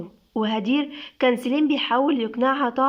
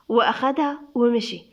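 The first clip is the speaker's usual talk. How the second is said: ordinary delivery, nothing marked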